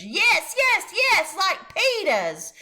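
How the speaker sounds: put-on high, loud child's insistence; toddler hollering. A narrator's reading. Fudge hollering back at the salesman. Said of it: "Fudge hollered".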